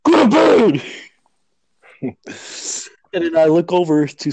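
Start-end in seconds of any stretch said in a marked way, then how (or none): 0.0-0.8 high, loud imitation of a dad; angry dad shout. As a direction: holler it as a fed-up parent's order. Dad orders son to bed.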